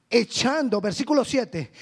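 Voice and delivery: normal talking voice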